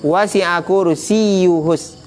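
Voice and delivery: very slow recitation, the ya in kursiyun doubled; teacher reciting the verse to show the shadda. Shown as a demonstration. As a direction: lean on the yy of kursiyun. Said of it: "pakai tasydid kan".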